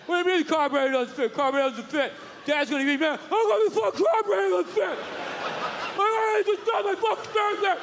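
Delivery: heated outburst building to high yelling; hammed-up garage meltdown; mock angry tantrum. Acted car show tantrum over carburetor.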